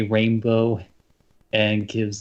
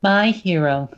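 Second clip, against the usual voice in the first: high, swooning princess voice; cartoon princess; 9 semitones higher, 5 dB louder than usual. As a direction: gush it like a fairy-tale princess. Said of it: "In specifically, uh, a certain princess's voice".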